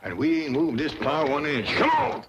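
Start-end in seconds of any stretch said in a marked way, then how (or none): 1.7-2.2 high shouted urging; urgent call. Acted. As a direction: yell at them to pull. Granny urges pulling plow.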